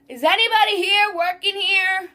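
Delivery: high, loud mock demand; rude customer. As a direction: imitate an impatient customer calling for service. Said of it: "rude-ass people that go".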